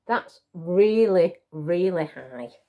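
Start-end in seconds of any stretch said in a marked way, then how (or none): none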